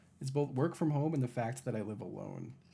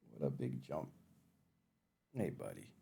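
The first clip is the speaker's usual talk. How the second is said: soft cooing; 8 semitones lower, 10 dB quieter than usual. Real, not acted. Streamer praising her cat's jump with a soft low coo. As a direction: lower and much softer, tender coo.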